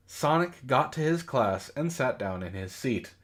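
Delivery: normal talking voice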